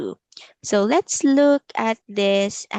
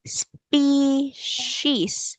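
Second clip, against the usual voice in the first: higher, stretched "species" in two parts; syllable by syllable; 5 semitones higher than usual. Shown as a demonstration. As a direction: sound out "species" as spe, then cies. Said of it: "let's say spe".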